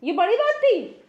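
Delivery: loud, rising disbelief; real affronted outburst; indignant anger. Real, not acted. Offended sister challenges them.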